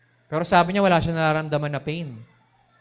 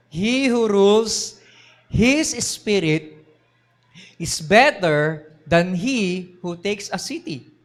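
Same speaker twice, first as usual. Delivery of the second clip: very slow, louder, weighty verse; solemn scripture reading; 4 semitones higher, 5 dB louder, much slower than usual. Shown as a demonstration. Preacher reading Proverbs to the congregation, slow and solemn. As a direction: lay out each word of the verse slowly. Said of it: "sabi ni Proverbs sixteen thirty-two".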